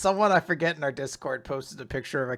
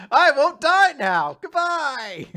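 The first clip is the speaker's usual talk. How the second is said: much higher and louder, slow, drawn-out cheer; gleeful shout; 11 semitones higher, 9 dB louder, much slower than usual. Retold or imitated. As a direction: yell a happy goodbye while running off. Imitating cowardly gleeful character.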